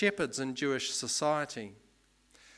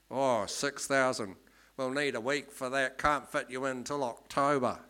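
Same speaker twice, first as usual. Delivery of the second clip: put-on Australian accent. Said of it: "this is my best Australian accent".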